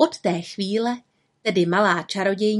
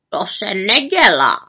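scolding raven, louder; reproachful; 5 dB louder than usual. A narrator's reading. Raven tells off the witch for spitting, reproachful. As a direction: scold like a stern croaking bird. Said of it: "řekl káravě".

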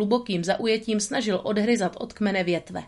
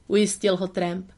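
ordinary delivery

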